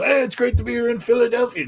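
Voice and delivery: high, big stage greeting; rock star greeting. Retold or imitated. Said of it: "he'd come out and go".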